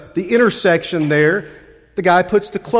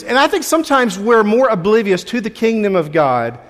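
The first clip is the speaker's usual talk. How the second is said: usual speaking voice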